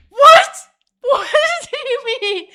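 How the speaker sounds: hammed-up high, very loud shriek; shrieked disbelief. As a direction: shriek it in mock horrified shock. Shocked reply to death sentence.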